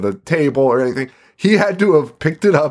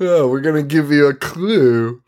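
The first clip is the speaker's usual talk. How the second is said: higher, hammed-up scheming villain; mock movie villain; 4 semitones higher than usual. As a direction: play the haunted house as a cheesy villain. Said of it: "The James Bond of fucking haunted houses".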